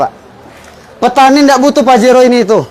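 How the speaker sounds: ordinary talk, nothing marked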